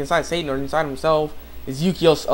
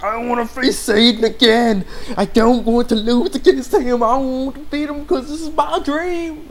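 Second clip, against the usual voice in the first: loud, high imitation, climbing higher to the end; mock anguished refusal; 9 semitones higher, 4 dB louder than usual. Bond refusing to face Satan, hammed-up anguish. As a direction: drag it out in a hammed-up wail. Acts out Bond's distressed refusal.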